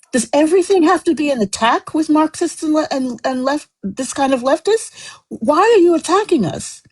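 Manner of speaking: rising real indignation; getting angry. Real, not acted. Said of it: "I'm getting angry".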